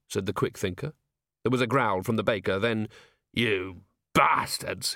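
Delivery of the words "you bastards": slow, growled-out curse; furious but held back in volume; angry shout. A narrator's reading. Baker growls and rounds on the thieves, angry. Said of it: "he shouted".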